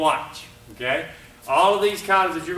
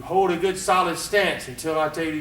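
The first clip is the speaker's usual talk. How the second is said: usual speaking voice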